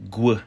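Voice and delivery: "gw" with a g leading into w. Shown as a demonstration. Teacher repeats the W variant, "gw" with g first. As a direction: sound out "gw" with a firm g. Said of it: "with a G preceding it".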